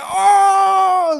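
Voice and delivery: very high, loud shouted oh; surprised shout. Retold or imitated. Birthday group shouts in surprise at the accent. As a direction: yell it out in amazement.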